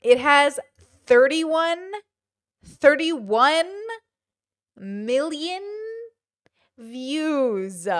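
loud, word-by-word amazement; stunned, halting repeat, pausing between words; emphatic disbelief. Real, not acted. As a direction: hit THIRTY-ONE loud, then space out each word. Repeats view count in amazement.

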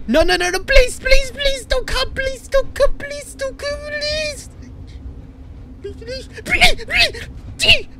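high, rapid-fire begging that thins out; panicked pleading. Real, not acted. Player begging Santa don't come, high panicked pleading. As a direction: beg in a squeaky, whimpering panic.

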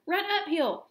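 high, urgent sheep call; excited high-pitched shout; crying out. A narrator's reading. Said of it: "cried the sheep".

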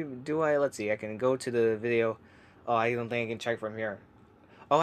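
ordinary talk

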